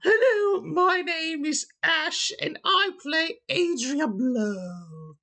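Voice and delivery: very high, slow put-on voice; mock Ash intro. GM fills in for absent Ash, high and slow. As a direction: squeak out Ash's intro for the absent player. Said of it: "now I get to do their intro".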